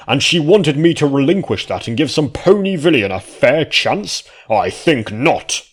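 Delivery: high, loud, outraged noble; indignant anger. A narrator's reading. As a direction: sputter with offended pride. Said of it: "mustache trembled in anger".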